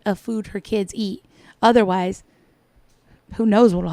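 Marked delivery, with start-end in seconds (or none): none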